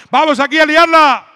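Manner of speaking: high, slow, loud stage shout; hammed-up hype yell. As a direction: yell it to whip up a crowd. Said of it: "la que voy a gritar".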